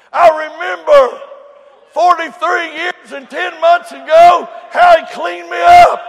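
loud, high, rising testimony; preaching at full force. Acted. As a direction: build the congregation to a shout.

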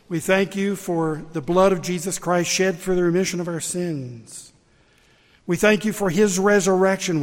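loud, building prayer of thanks; fervent prayer. Real, not acted. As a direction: pray out with rising fervour. Leads the closing prayer.

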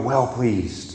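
hushed answer. Shown as a demonstration. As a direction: let "Well pleased" drop away softly.

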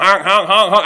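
silly honking noises; silly clown. Shown as a demonstration. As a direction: honk like a clown horn. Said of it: "I can honk with the best of 'em".

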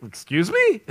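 high-pitched, mock-taken-aback question; retold incredulous comeback. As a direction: play up polite disbelief with a jump in pitch. Reacts to claim grace is earned.

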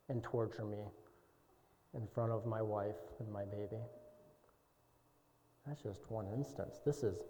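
very soft, low and intimate; hushed confession. Real, not acted. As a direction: confess it almost under the breath. Quiet confessional aside.

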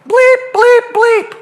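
high bleeps standing in for cursing; mock censor bleeps. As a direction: chirp the swear words out as censor beeps. Censored cursing at another driver.

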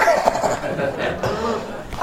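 wheezy, mostly voiceless chuckle; laugh. Real, not acted. Preacher joking about heaven to congregation, soft chuckle. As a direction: laugh at your own remark.

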